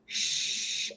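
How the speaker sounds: a hushed, unvoiced "sh"; a long voiceless sh shown to the class; sh sound. Shown as a demonstration. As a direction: let "sh" hiss out without any voice. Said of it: "there is no sh".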